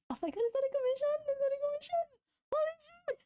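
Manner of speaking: high and fast, breathless excitement; real delighted shriek; thrilled squeal. Real, not acted. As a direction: gush over the find. Spots a commission in the files.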